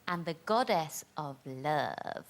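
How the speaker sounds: low, slow husky soap-star voice; Sharon from EastEnders. Acted. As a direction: drop low and drawl it slowly. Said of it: "that was Sharon from EastEnders".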